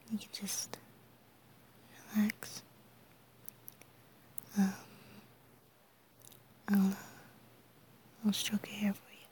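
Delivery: ordinary talk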